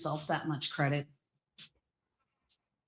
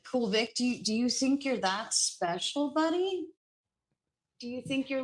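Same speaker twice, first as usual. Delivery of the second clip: mocking sarcasm; 5 semitones higher than usual. Real, not acted. Mocking a rival.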